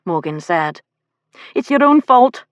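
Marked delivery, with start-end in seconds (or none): 1.5-2.4 higher, louder, unsympathetic retort. A narrator's reading. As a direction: throw the blame back without sympathy. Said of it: "said without a trace of sympathy".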